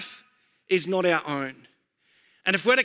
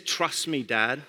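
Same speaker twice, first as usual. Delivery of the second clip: put-on lower-pitched, self-assured little kid; daughter imitation; 7 semitones lower, 3 dB quieter, much slower than usual. Daughter telling her dad to trust her, lightly imitated. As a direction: play a small child reassuring dad. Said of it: "This is how she talks".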